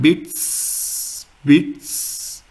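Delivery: beats with T and S run together at the end; joined TS sound. Shown as a demonstration. Teacher comparing German Z to English, joined TS sound. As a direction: roll the final ts of beats into one long hiss. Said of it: "roll up T S jointly in beats".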